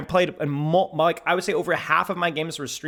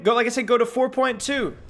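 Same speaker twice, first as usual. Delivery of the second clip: ordinary talk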